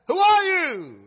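high, loud call upward; hanging man calls to the voice; frantic shout. Acted. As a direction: shout into the sky. Man asks the voice.

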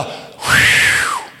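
long, forceful blown whoosh; wind whoosh. Retold or imitated. Preacher showing the wind hitting you, a big whoosh. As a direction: imitate a gust hitting you.

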